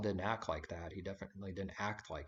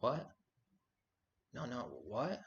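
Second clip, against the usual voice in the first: high startled what, imitating confusion; mock surprise; 4 semitones higher, slower than usual. People caught off guard by true words, mock surprise. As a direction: play the baffled questioner. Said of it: "And they're like".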